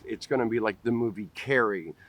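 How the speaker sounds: ordinary talk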